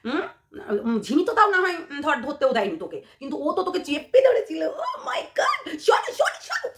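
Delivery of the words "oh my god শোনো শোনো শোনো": much higher squeaky mock shriek; mock shocked squeal. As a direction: squeal it like a scandalized gossip. Mocking someone's shocked squeal.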